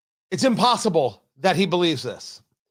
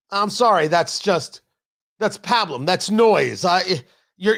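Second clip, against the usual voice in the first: normal talking voice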